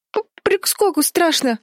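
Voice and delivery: very high, frightened little voice; stammering. A narrator's reading. Чарли is afraid of the leap, stammering. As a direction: stutter on the first sound like a scared child. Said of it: "запинаясь, проговорила та".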